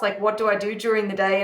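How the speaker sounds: ordinary talk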